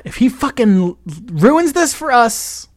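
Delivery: retold frustrated venting, rising at the end; exasperated commiserating. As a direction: vent like a worried voter, mildly hammed-up. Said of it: "we were kind of commiserating".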